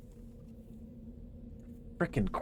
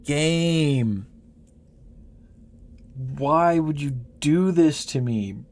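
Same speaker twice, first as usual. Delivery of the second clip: loud complaint to the game; 4 semitones higher, 6 dB louder, slower than usual. Real, not acted. Scolding the game loudly.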